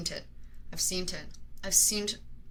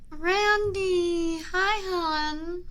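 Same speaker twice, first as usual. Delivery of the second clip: excited greeting; 10 semitones higher, 4 dB louder, much slower than usual. Real, not acted. Greeting partner who shows up.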